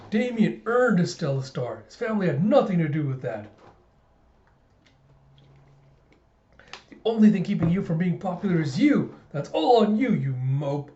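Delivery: acting out a loyal sidekick's indignant defense. Flunkies defend Damien against George, indignant. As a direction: push the key words, scolding and emphatic.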